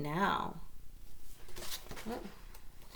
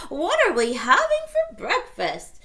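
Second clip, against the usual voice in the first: granny voice; 11 semitones higher, 9 dB louder than usual. Acted. Granny asks.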